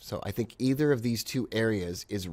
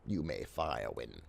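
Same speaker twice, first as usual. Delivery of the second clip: Star Wars villain quote; 10 semitones lower, 9 dB quieter than usual. Retold or imitated. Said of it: "A little Star Wars quotations".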